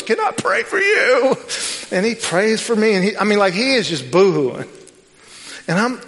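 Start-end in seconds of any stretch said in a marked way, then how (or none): 0.0-1.4 homeless man asking. Retold or imitated. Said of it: "he goes".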